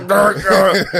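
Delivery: playful grr growl; mock growling. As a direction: growl it high and loud. Mimics Phil's heart attack.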